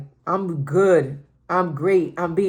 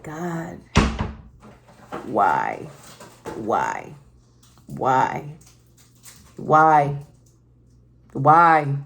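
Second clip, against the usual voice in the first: retold crying; 5 semitones lower, much slower than usual. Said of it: "I remember just crying to God".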